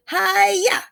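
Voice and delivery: high ninja-style hi-ya; mock martial-arts cry with an axe swing; lifted, playful chopping shout; play chop yell. A narrator's reading.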